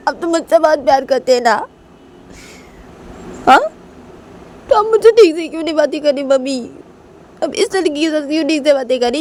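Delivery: high-pitched, whining protest to mother; upset, jealous daughter. Acted. Daughter complaining that mummy favours the other girl, high and aggrieved.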